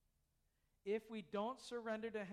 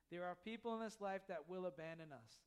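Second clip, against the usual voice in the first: ordinary talk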